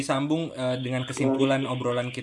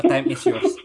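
laughing; 9 dB louder, slower than usual. Real, not acted. Handing over with a laugh.

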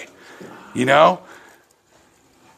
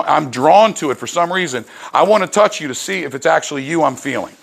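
ordinary talk, nothing marked

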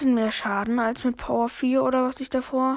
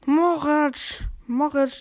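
calling out; 5 semitones higher, 5 dB louder, much slower than usual. Real, not acted. Spotting an enemy player.

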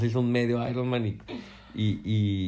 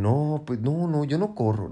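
excuse-making wife voice; 5 semitones higher than usual. Retold or imitated. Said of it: "lo primero que dicen".

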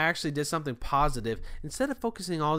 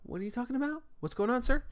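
higher-pitched, polite customer-service voice; nice guy voice; 7 semitones higher than usual. Retold or imitated. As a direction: put on your sweet, obliging nice-guy act. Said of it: "put my nice, nice guy voice on".

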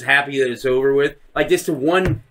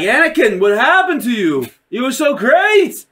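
overdone heartbroken appeal; mock anguished plea; 7 semitones higher, 4 dB louder than usual. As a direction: cry out to a fallen friend, hammed up. Voicing Obi-Wan pleading with Anakin.

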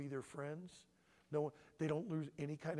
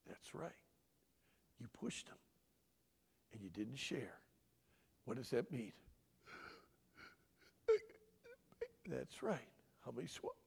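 hushed, low parent voice; walk the child through it softly and deliberately; methodical; 4 semitones lower, 8 dB quieter than usual. Acted. Dad reviewing the offense with his child, soft and methodical. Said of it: "it's very methodical".

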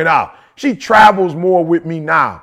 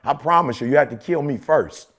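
determined vow; 5 semitones lower than usual. Retold or imitated. Speaker retelling his vow after a knockdown, low and determined. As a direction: drop the voice and promise it like your life depends on it.

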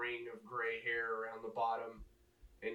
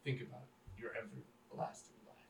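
softer, half-whispered urging; soft persuasion; 11 dB quieter than usual. Acted. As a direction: lean in and coax quietly. Priest pressing softly.

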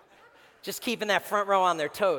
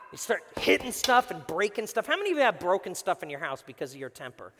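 ordinary talk

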